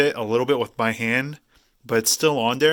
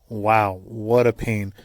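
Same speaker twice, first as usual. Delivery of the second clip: ordinary delivery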